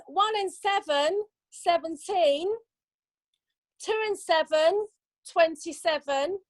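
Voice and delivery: slow sing-song number calling; bingo caller. Acted. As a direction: call each number drawn out.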